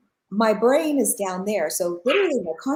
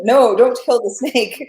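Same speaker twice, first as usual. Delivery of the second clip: louder, slow, begging "No, don't kill the snake"; pleading child; 4 semitones higher, 9 dB louder, slower than usual. Retold or imitated. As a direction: plead with Daddy like a scared little girl, drawn out. Child begging father to spare snake.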